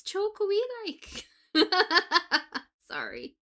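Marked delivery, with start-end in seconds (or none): 0.0-1.3 Geordie lilt, higher and slow, a bit louder; mock Geordie accent. Teasing the Geordie friend with a mock Geordie accent. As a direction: stretch it out in a jokey Geordie voice. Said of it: "speak Japanese in your accent".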